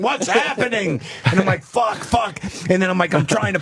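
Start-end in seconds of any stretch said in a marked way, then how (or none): 0.0-1.0 high, bewildered onlookers; mock puzzled bystanders calling out; confused neighbors. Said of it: "people in bathrobes going". 1.6-2.4 high, frantic under-breath curses; quick high panicked swearing. Retold or imitated.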